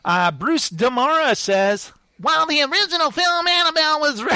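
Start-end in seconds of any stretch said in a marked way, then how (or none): 2.2-4.3 lightly nasal reviewer voice, fast; a hint of a nasally fella; nasal critic voice. Reading a review to his co-host in a mild nasal voice. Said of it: "he's very nasal".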